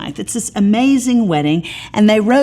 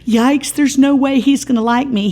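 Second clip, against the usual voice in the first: a squeaky higher yikes, mock panic; hammed-up girlish worry; self-doubting girl; 8 semitones higher, 3 dB louder than usual. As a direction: play her sudden doubt, much higher and a bit louder. Shulamith's doubt voiced.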